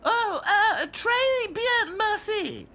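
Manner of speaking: very high, halting French; frightened princess doll. A narrator's reading. Princess dolls answering the French wizard, frightened. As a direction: stammer polite French in fright.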